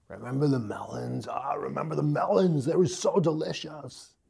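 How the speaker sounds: put-on Arnold accent. Preacher voicing Israelites missing Egypt in an Arnold accent. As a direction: drift into a lazy Arnold accent. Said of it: "I don't know why I sound like Arnold".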